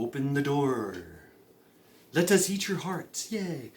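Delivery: soft, teasing creature voice; mind-controlling alien invaders. Acted. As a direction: coax the victim as a mock-sinister alien. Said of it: "they can go".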